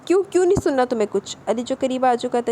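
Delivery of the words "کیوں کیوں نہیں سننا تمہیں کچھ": sharp, raised angry question; angry retort. A narrator's reading. Ali angrily questions Nisha in public, raised voice. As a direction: snap back at her, lightly acted anger. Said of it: "غصے سے بولا".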